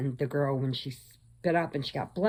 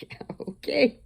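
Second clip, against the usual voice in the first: sarcastic whisper; 9 semitones higher than usual. Retold or imitated. Dismissive aside to the workers.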